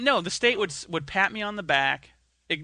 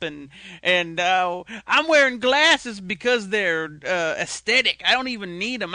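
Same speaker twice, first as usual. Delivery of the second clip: mocking hipster girl; 3 semitones higher, 5 dB louder than usual. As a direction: parody a self-satisfied thrift-shop student. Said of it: "I think I went".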